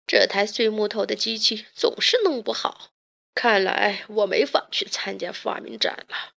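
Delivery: lightly voiced discouraged father; a touch of defeat in a character voice; dejected. A narrator's reading. Inventor gives up on his broken machine, mildly dejected. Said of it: "他沮丧地说".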